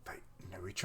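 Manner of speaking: hushed, breathless whisper; stunned little whisper to yourself. Real, not acted. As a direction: whisper it like a secret you just spotted. Surprised aside while watching.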